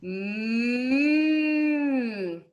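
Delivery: long closed-lip moo; sustained humming moo; cow moo imitation. Shown as a demonstration. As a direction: hum a moo through closed lips. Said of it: "If you keep your lips closed".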